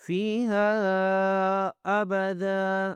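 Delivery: ابدا ending on a long "daa", no n; slow tajweed stop on ابدا; pausal recitation. Shown as a demonstration. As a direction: chant فیہا ابدا slowly, holding the vowels. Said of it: "میں نے ابدا کیوں کیا ہے".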